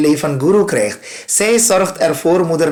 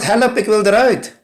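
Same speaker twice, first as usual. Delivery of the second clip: mock cry for help; 6 semitones higher than usual. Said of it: "Die gilt".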